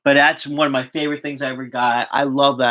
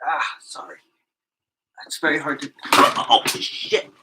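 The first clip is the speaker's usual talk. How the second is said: pained whisper; breathy and strained from foot pain; limping; slower than usual. Real, not acted. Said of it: "my foot is killing me".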